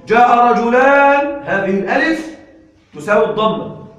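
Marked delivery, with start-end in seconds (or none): 0.1-1.4 "رجلان" stretched slowly and louder, -ān ending clear; slow emphatic example. Shown as a demonstration. Teacher contrasts dual with singular for students, slowly. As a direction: spell out "جاء رجلان" word by word. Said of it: "جاء رجل مرفوع بالضم".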